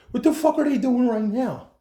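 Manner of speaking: high, loud disbelieving shout; an outburst over what the hospital is doing; exasperated outburst. Retold or imitated. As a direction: vent at them, stunned.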